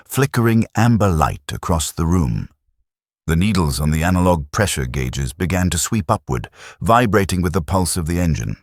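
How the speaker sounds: ordinary delivery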